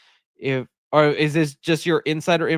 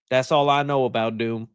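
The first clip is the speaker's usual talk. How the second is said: usual speaking voice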